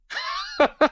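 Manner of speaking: very high-pitched cackle; acted amazed laugh. Pair witnessing a healing, high giddy laughing shriek.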